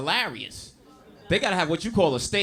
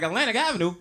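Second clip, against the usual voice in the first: street dealer; 6 semitones higher than usual. Retold or imitated.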